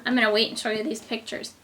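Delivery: ordinary talk, nothing marked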